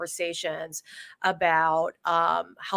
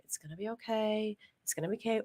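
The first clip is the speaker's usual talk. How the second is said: soft, hushed reassurance, much quieter; quieter inner voice; 2 semitones higher, 10 dB quieter, faster than usual. Retold or imitated. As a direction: let it fall to a calm inner voice. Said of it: "that quieter voice just kept saying".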